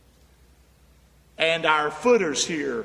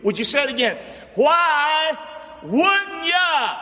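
high, loud call to the crowd; rally the congregation to shout it back; preaching at full force; 8 semitones higher, 4 dB louder than usual. Acted. Preacher calling his crowd to repeat the title, loud and high.

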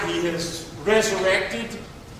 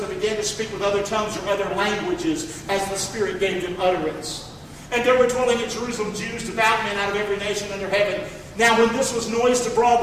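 usual speaking voice

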